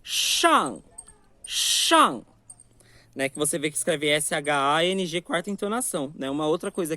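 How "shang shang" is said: Shang com a boca bem aberta, tom descendente; open shang. Shown as a demonstration. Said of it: "abrir a boca". (shang com A aberto)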